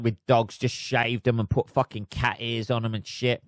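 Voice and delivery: ordinary delivery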